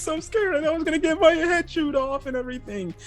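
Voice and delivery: very high, far falsetto whine; squeaky Ulti. Retold or imitated. Ulti whimpers to Page One, high falsetto. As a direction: play a scared girly whimper. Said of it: "Ulti's like".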